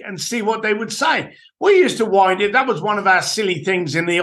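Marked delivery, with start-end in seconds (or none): none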